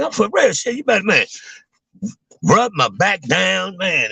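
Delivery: high, pleading insistence; insisting to the wife. Retold or imitated. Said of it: "I'm like".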